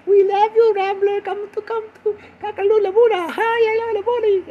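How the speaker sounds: very high, squealing fan voice; adoring fan club. Acted. Malaysian fan club greeting Rambler, squealing mock adoration. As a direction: gush loudly into silly babble. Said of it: "They were like".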